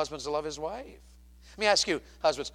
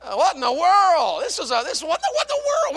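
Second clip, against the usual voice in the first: high, loud mock bewilderment; sputtering, high-pitched exclamation; baffled outburst; 12 dB louder than usual. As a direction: cry out in comic disbelief. Baffled reaction to the boy's answer.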